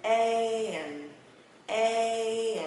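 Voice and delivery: long, loud "E" gliding into a final N; drawn-out E with N at the end; tongue up for N. Shown as a demonstration. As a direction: stretch "E" and curl the tongue up into N. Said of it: "Và quấn chữ N lên nè".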